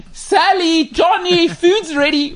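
high, loud mock parent calling; yelling across the room. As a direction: call the kids in for dinner, high and loud. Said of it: "why can we hear the next door neighbors".

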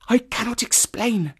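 high, hushed, eager woman; low, eager voice. A narrator's reading. Woman refuses to explain her warning, eager. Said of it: "She spoke in a low, eager voice, with a curious lisp".

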